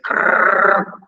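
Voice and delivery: rattling "krrr" from the throat; rolled gurgling noise; imitated throat gurgle. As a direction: mimic air rattling out of a cut throat. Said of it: "Suara keluar dari sini".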